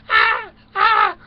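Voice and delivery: high, loud squealing woman's voice; high falsetto plea for leaves; whiny Eve. Acted. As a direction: shriek like a fussy girl begging. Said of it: "Eve is going".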